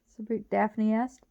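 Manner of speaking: usual speaking voice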